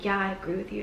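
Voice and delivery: low, hushed mock seriousness; very serious. Shown as a demonstration. Matching a stressed friend's deep voice, very serious. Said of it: "become very serious".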